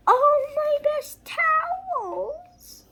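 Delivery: high, very slow lament; slow high mournful cry; dismayed wail. A narrator's reading. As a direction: wail over the towels. Mrs Rogers dismayed.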